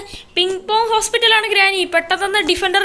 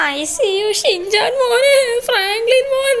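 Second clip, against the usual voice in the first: put-on higher, crying old granny; grandma sobbing over the lost grandson; tearful granny; 5 semitones higher, slower than usual. Granny mourning the dead grandson. (കരഞ്ഞുകൊണ്ട് മുത്തശ്ശി)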